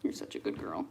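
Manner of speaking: low unvoiced whisper to the dog; whispered praise. Real, not acted. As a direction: murmur praise softly.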